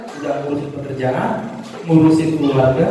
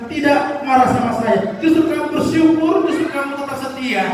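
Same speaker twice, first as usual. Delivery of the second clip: God praising, pitched much higher; God's voice; 8 semitones higher than usual. Acted. Preacher voicing God rewarding a faithful believer, high and pleased. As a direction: lift the voice high, delighted with the child.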